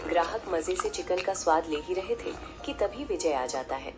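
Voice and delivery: ordinary delivery, nothing marked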